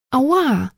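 high sharp cry of pain; ouch of pain. A narrator's reading. Pia crying out as the brush pulls again. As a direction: yelp at the tug.

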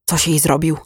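plain reading voice

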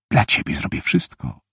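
whispered and soft; secret whisper. A narrator's reading. Girl silently promising the boy she likes, whispered. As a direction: breathe it as a private vow. Secret thought about Elijah.

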